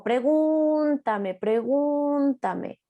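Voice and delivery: put-on very slow, high, drawn-out child's echo; high sing-song echolalic child. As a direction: stretch each syllable like a repeating child.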